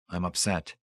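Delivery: soft, drained admission; understated fatigue, softly spoken; weary. A narrator's reading. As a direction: admit it tiredly, voice dropped back. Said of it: "he said wearily".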